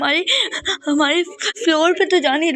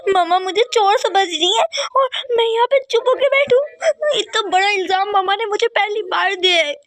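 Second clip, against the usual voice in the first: high, tearful wail of hurt; crying protest; 6 semitones higher than usual. Acted. Accused girl crying to her uncle about the theft charge. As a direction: cry out at the unfair blame.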